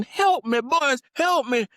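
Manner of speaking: high, loud, slow cry for help; old man's desperate plea. Retold or imitated. Old man stung by wasps begging the boys, desperate cry. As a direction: wail it like he's being stung. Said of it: "So he's fumbling".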